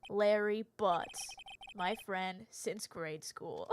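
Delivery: Phoenix narrating. Acted. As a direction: tell the backstory calmly.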